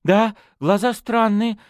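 put-on high, loud, slowed alarm of a young woman; agitated. A narrator's reading. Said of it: "заволновалась Мэгги". (взволнованно, высоким голосом)

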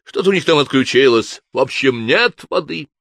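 high, loud exasperated yelling; angry shout. A narrator's reading. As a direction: throw up your hands and shout. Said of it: "взревел инквизитор".

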